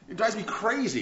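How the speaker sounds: usual speaking voice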